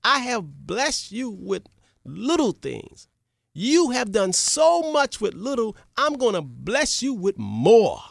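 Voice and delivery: high, slow joyful praise; overjoyed king. Acted. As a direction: pour praise on the servant. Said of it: "The king was so happy".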